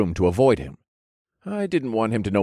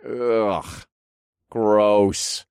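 disgusted. A narrator's reading. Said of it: "I grimaced".

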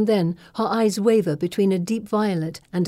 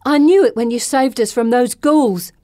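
ecstatic; 5 semitones higher, 7 dB louder than usual. Acted. May prostrate, ecstatic believer.

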